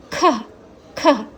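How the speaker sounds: खह with breathy h ending; syllable demo. Shown as a demonstration. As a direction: sound out खह. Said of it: "ये हो गया आहा". (खह को साफ़ बोलें)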